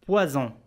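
بوازون with the s voiced as a z between the vowels, in French style; French pronunciation. Shown as a demonstration. Teacher demonstrating to learners the z sound in poison. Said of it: "إس جاءت بين إي وأو نطقت زد".

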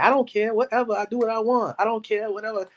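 high, whiny cartoon-kid voice; Cartman impression. Streamer quoting Cartman on Maury in a high bratty whine. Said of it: "it was Cartman off of South Park".

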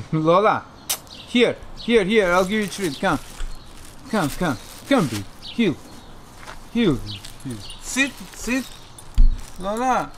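high, loud commands to a dog; calling a dog. Real, not acted.

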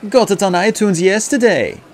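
put-on keen fan with a German accent; distinctive German accent. A narrator's reading. Second fan answering the star, German accent. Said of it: "in the same distinctive accent".

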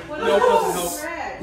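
laughing. Real, not acted. Laughing through spicy pain.

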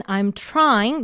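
"trying" pressed with a rise; stress on trying. Shown as a demonstration. Teacher marking the stress, "trying" pressed. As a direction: push "trying". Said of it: "누르시고".

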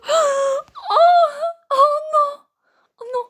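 high giggly squeal; laughing squeal. Real, not acted. Squealing at ferret photos.

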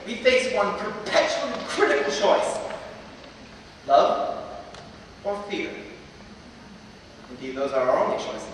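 usual speaking voice

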